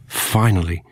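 usual speaking voice

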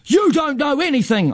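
very high indignant retort; high-voiced squabbling shout without much extra volume; narrated shout. A narrator's reading. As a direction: throw it back at him, pitched right up. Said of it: "I shout".

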